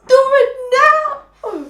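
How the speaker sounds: high, loud, desperate plea; impatient begging woman. Retold or imitated. Woman begging her partner to hurry, high and loud. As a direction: cry out like a woman who can't wait any longer. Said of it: "She will be like".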